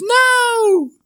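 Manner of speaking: shrill high shriek of no; princess shriek. Acted. Princess shrieks as the plane drama unfolds, very high. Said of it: "She goes / shrieking".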